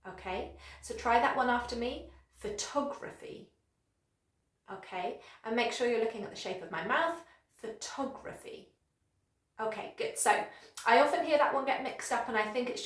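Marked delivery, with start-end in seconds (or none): 2.4-3.5 PHOTOGRAPHY softly as fuh-TOG-ruh-fee; weight on TOG in "photography", soft start; second-syllable stress. Shown as a demonstration. Teacher has learners repeat, second-syllable stress. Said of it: "stress is on the second syllable". 7.6-8.7 PHOTOGRAPHY very softly as fuh-TOG-ruh-fee; second-syllable stress. Shown as a demonstration. Teacher shows the mouth shape, second-syllable stress. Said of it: "stress is on the second syllable".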